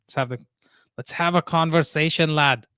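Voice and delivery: amateur Scottish accent; hammed-up Scottish accent; attempted Scottish accent. Shown as a demonstration. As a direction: try your best Scottish lilt. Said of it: "trying to do my best Scottish accent".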